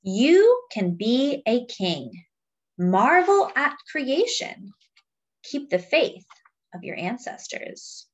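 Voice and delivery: slow, weighty refrain then lively lines; storybook reading with a slow refrain; reading to children. Acted. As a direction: read the book aloud to the class. Reading picture book to children.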